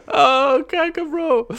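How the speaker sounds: high, squeaky laugh through the words; laughing to tears. Real, not acted. Streamer moved by his own story, tearful laughing. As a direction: choke it out high, laughing helplessly. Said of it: "Ich hab wirklich Tränen in den Augen".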